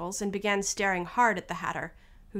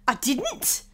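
higher, hasty denial; hurried interruption; 5 semitones higher, 4 dB louder than usual. Acted. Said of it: "interrupted in a great hurry".

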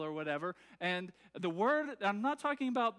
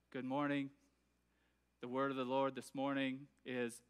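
lower, flat, uninflected reading voice; monotone reading; 7 semitones lower, 3 dB quieter than usual. Retold or imitated. Preacher imitates Jonathan Edwards reading, monotone. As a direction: read it with no inflection at all. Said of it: "No tone inflection, no volume changes".

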